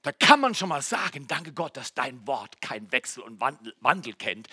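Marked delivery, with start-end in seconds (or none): none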